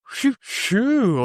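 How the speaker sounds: high playful catcall sounds, imitating the neighbor; a neighbor catcalling as a joke, high teasing shoo; mock catcall. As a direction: call out like a guy whistling at someone on the street. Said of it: "you do the, the cat whistle".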